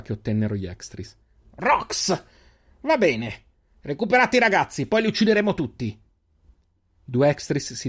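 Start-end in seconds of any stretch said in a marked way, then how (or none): 1.6-6.0 high furious barked commands; angry orders. Acted. Bitten Extris leader ordering everyone killed, high angry commands. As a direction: bite out the orders in pain.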